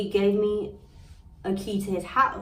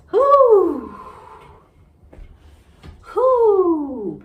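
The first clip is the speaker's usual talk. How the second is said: loud high whoo, twice; 10 semitones higher, 10 dB louder than usual. Retold or imitated. Woman venting about a cheating boyfriend, loud high whoo. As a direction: let out a big exasperated whoo. Recalling how angry she was.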